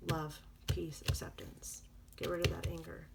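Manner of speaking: soft breathy-free whisper of each word. Real, not acted. Card reader telling listener what they need, whispered. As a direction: breathe the words out gently.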